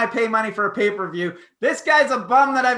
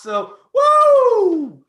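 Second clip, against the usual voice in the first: high, loud woo; Flair woo; 13 semitones higher, 9 dB louder than usual. Retold or imitated. Host imitating Ric Flair's chop woo, loud and high. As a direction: belt out a wrestler woo.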